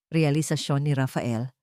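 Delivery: usual speaking voice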